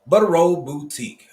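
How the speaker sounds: high, loud greeting call; loud shout-out. Real, not acted. Host greeting a viewer on the stream, loud and high. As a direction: call the viewer's name out brightly.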